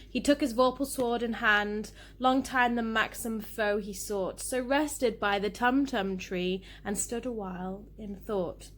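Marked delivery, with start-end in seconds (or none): none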